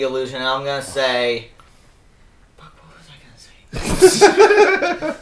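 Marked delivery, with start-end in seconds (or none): 2.6-5.2 laughing whisper. Real, not acted. Forgets line, laughing.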